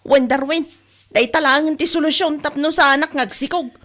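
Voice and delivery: loud retort. Acted. Wife shouting back at Darwin about the children. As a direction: fire it back hard at him.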